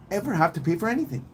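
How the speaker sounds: normal talking voice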